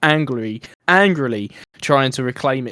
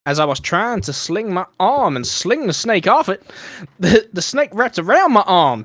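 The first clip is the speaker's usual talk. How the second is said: put-on Texan accent; 6 semitones higher, 3 dB louder than usual. Reading the snake victim's words to mates in a Texan accent. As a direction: read the quote as a Texan lady. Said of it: "should I do the accent?".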